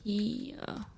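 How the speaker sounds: whisper. Real, not acted. Sneaking through enemy area.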